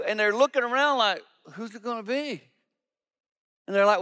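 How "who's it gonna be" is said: soft, hushed worried question; whisper-ish dread among friends; nervous wondering. Retold or imitated. Disciples glancing around after the betrayal warning, soft and nervous.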